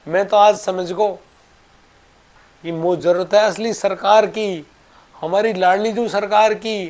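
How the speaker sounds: high, loud indignant retort; acted anger. Baba tells Thakur ji he is not needed, acted anger. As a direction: scold loudly as the fed-up old devotee. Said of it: "वो बिल्कुल गुस्से में".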